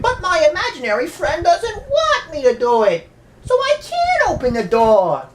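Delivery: put-on high, innocent little-girl voice; creepy little girl. Young girl tells parents why the door stayed shut, high childish voice. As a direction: sound like a spooky child excusing herself. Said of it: "she's like".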